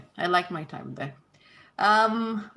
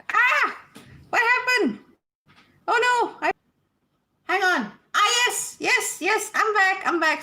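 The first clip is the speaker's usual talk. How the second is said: much higher and louder, caught off guard; startled and flustered; 12 semitones higher, 4 dB louder than usual. Real, not acted. Host startled after pressing the wrong button, flustered and high. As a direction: yelp in alarm, then relief.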